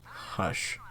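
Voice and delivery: ordinary talk, nothing marked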